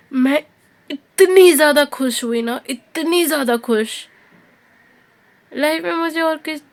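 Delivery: loud, high rush of joy; bursting out with delight, then easing; real excited burst. Real, not acted. Recalling seeing her father after surgery, loud and overjoyed.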